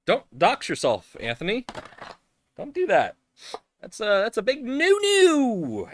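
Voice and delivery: high, loud nagging voice to himself; mock self-scolding. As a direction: tell yourself off like a fussy parent.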